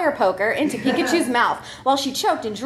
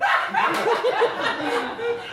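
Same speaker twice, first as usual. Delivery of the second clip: high real laugh; 10 semitones higher than usual. Real, not acted. Reader laughing at the gag description, high laugh. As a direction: laugh out at the absurd line.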